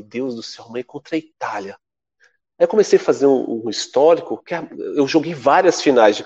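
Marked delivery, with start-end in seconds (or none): 0.0-1.8 soft, low worried murmur; a quiet, lower gasp of worry about Italy; hushed dread. Retold or imitated. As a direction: breathe it out, dreading tomorrow. Retold dread before the Italy game.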